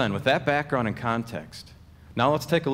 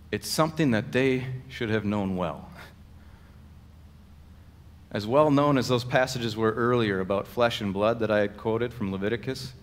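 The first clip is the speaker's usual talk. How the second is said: ordinary talk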